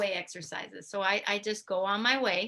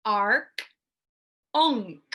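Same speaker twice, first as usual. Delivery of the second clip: "arc" y "onk" exaggerated, loud and open-mouthed; goofy exercise sounds; 5 dB louder than usual. Shown as a demonstration. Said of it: "you say".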